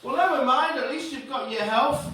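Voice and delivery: high-pitched, cheery reassurance; breezy consoler. Acted. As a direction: brush off bad news in a high voice. Consoling friend in acted dialogue.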